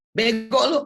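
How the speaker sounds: mock-insulting a friend; mock taunt. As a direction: jeer like a teasing friend.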